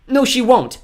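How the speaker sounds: high, loud defiance; defiant girl. Acted. Girl defies villain's threat.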